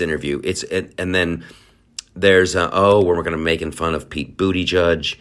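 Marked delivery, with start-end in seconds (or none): none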